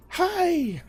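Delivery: very high, drawn-out falsetto greeting; excited dog. Acted. Voicing dogs as you walk in, overjoyed falsetto. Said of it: "are gonna be excited that you came home".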